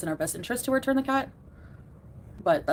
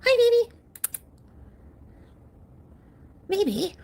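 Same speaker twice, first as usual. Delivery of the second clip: very high pet-greeting coo, louder; 14 semitones higher, 6 dB louder, slower than usual. Real, not acted. Owner calling her cat baby, high cooing. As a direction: sing out a high hello to the cat.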